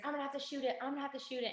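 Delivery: high, mock-urgent voice without shouting; high-pitched acted-out alert; retold urgent warning.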